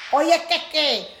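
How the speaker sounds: cocky mock pep talk to yourself; mock boastful self. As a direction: brag like you'll conquer the world. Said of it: "uno se levanta por el día".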